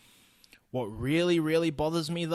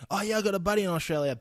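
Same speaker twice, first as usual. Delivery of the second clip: higher, louder, fast mocking singsong; mocking imitation; 8 semitones higher, 5 dB louder, much faster than usual. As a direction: parody the eager small talk.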